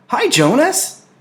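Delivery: loud, high friendly call across the room. Acted. Asher calls out to Jonas across the room, loud and high. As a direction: call his name out across the bathing room. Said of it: "Asher called from the corner".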